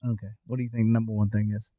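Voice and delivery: firing off a coach's quiz question; gym coach. Real, not acted. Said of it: "You sound like the, the coach from Dodgeball".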